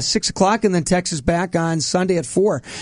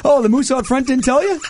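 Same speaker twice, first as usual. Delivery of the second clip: moose from Vacation; 6 semitones higher than usual. Acted. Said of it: "the moose from the Vacation movie".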